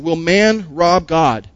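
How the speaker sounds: very slow, weighted question; Will man rob God, dragged slowly; slow reading. Acted. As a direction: draw the question out.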